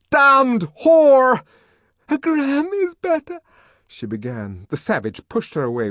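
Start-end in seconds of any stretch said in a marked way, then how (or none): 0.1-1.4 high, loud enraged cry; furious shout. A narrator's reading. John raging. 2.1-3.4 frightened plea. A narrator's reading. Lenina pleading frightened.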